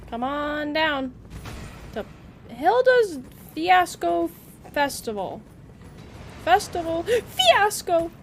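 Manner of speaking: slow, high and loud showman call; hammed-up announcer. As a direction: bark it like a carnival host. Carnival invitation to the fiasco.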